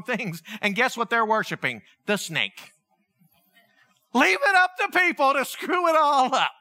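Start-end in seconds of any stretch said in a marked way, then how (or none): 4.1-6.5 loud, higher, fed-up cry; exasperated shout. Real, not acted. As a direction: throw it out to the room in frustration. Exasperated preacher outburst.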